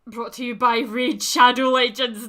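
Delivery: loud, slow announcer plug; mock sponsor read. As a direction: boom it out like a fake ad.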